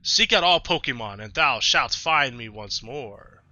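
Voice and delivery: loud, commanding divine decree; Arceus, godlike narrator. Acted. Player voicing Arceus sending the hero off, loud and grand. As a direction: issue the quest like a god. Reads the game's Arceus dialogue aloud.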